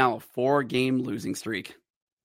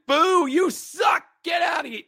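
mock booing; 3 dB louder than usual. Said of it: "It wasn't".

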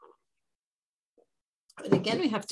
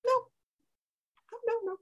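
tribe's mock refusal, last nos pitched much higher; stubborn refusal; faster than usual. Teacher voices the tribe of Binyamin refusing, mock stubborn. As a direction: repeat no like a stubborn crowd digging in. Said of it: "The people of Binyamin say".

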